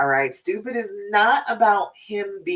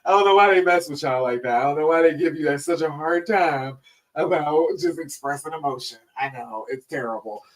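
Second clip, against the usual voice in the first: tender comfort; 3 semitones lower than usual. Retold or imitated. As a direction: comfort him gently like a loved one. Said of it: "I was like".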